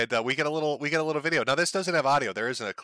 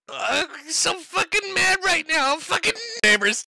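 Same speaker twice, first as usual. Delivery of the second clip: very high, loud, crying squeal of a furious little man; hammed-up high-pitched wail at the burger throwers; shrieking mock Nick; 5 dB louder than usual. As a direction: shriek it like a toddler having a tantrum.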